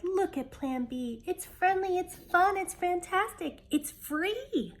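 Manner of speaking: high, loud, eager cheering; excited cry. A narrator's reading. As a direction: sell it like thrilled kids. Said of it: "The kids in a chorus cried".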